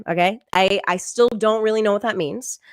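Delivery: usual speaking voice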